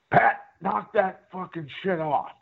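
mock reprimand over the intercom; mock scolding producer. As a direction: imitate an exasperated producer in your ear.

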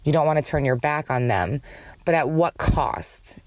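ordinary talk, nothing marked

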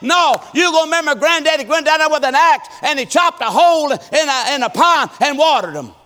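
loud, high preacher's call; preaching at full force. Acted. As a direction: drive it home to the congregation.

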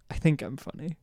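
ordinary talk, nothing marked

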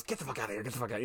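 quick mock shooing, kept well down in volume; hushed urgency. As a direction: imitate hustling someone out unheard. Hidden host ushers someone out, retold.

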